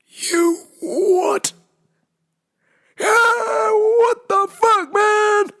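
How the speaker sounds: shocked angry outcry at her dad; incredulous outburst. Retold or imitated. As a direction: explode at dad in disbelief.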